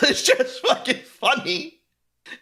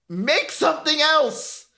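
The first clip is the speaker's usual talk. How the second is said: higher, drawn-out mock plea; mock begging; 6 semitones higher, slower than usual. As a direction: plead slowly, imitating fed-up fans. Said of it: "people are begging".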